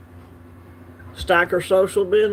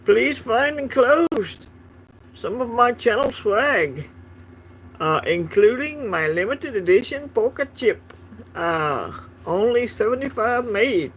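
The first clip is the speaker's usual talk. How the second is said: a lightly put-on Scottish accent, higher and slow; mock Scottish accent; 5 semitones higher than usual. Reading a Scottish fan's letter aloud in a playful mock Scottish accent. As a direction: read the fan letter in a hammed-up Scottish accent. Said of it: "I know my accent is horrible".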